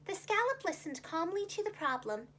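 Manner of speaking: ordinary delivery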